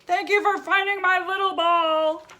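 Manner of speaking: high, loud friendly giant voice; a big whale thanking the friends, high and loud; big guy voice. Acted. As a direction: boom it out like a cheerful giant.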